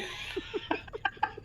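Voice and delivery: silent, airy wheeze of a laugh; breathless laugh. Real, not acted. Player wheezing with laughter at the headless corpse.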